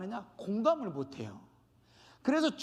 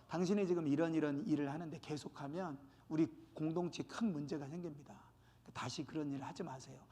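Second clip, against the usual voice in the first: low, soft private admonition; 조용한 권면; 6 semitones lower, 8 dB quieter than usual. Acted. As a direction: counsel someone quietly, voice kept down. Acting out private admonition of wrongdoer. (낮고 조용히 타이르듯)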